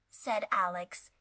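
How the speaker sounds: ordinary delivery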